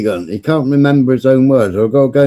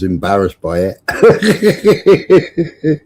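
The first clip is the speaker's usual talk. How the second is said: breaking into a chuckle; amused, giggling at own remark; laughing; 4 semitones higher, much slower than usual. Real, not acted. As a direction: end the line in a run of laughter.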